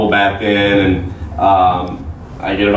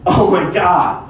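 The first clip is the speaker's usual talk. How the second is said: high-pitched mocking imitation of a furious tirade; retold scolding; 7 semitones higher than usual. She scolds him for the minibar blunder, exasperated. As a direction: scold in a high voice, stretching the insults, then rushing. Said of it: "And she goes".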